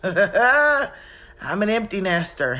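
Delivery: slow, loud laughing declaration. Real, not acted. Mother celebrating the empty nest, laughing loudly. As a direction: laugh it out with relief.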